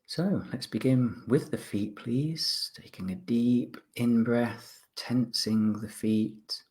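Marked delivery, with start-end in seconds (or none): none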